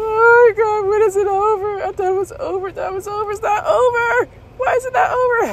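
very high, wailing mock panic; acted-out ride hysteria; screaming. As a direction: plead frantically in a strained squeal. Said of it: "I'm screaming now".

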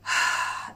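long breathy exhale through the mouth; audible exhale. Shown as a demonstration. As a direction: breathe out audibly to show the release. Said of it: "e poi".

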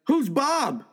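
high-pitched indignant question; outraged surprise. Acted. Husband confronting wife over her tattoo, shocked.

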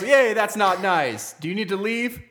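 loud, high, slow reprimand; mock-scold a small child; hammed-up teacher telling a kid off; imitated scolding teacher.

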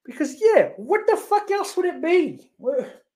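high, exasperated disbelief; incredulous. Real, not acted. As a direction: squeal out how obvious it is. Incredulous mocking outburst.

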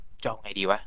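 soft, slow worried mutter under the breath; muttered to self. Retold or imitated. Conan stuck in the burning tower, muttering softly to himself. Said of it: "ก็เลยคิดว่าแบบ".

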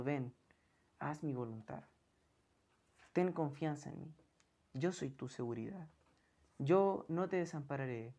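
a soft, reassuring voice of God; tender divine voice. Acted. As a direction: speak as a loving Father calling his child. Preacher voices God inviting trust.

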